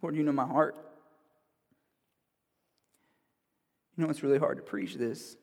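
soft, hushed prayer, slipping into whispers; soft heartfelt prayer. Real, not acted. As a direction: pray quietly and humbly before God. Opening prayer before the sermon.

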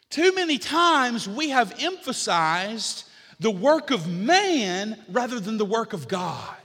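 high, loud, driving sermon cadence; full-force preaching. Real, not acted. Preacher listing believers' truths to the congregation, impassioned and loud.

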